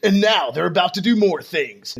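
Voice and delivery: slow, dramatic recap announcer; previously on recap. Acted. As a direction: build it like a TV recap intro. Recap of last session.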